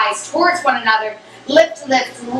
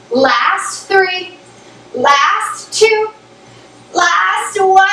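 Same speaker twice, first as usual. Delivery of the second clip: rising excited countdown; 5 semitones higher, much slower than usual. Real, not acted. Instructor counts down final reps.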